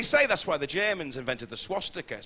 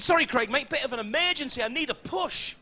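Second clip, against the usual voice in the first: a higher voice for the neighbour; neighbour asking; 9 semitones higher than usual. Retold or imitated. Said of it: "He said".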